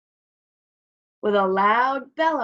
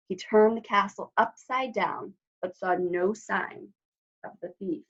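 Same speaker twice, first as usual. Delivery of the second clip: plain reading voice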